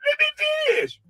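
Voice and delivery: very high, wailing mock whine; mock crying. Mocking a rival pushed around on a panel, tearful high whine. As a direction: imitate him as a squealing crybaby begging for a word.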